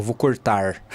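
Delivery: "cortar" slow, with an overdone final R; R final exagerado. Shown as a demonstration. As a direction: overpronounce the R that ends "cortar". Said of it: "Você não diz". ("cortar" devagar, com R final carregado)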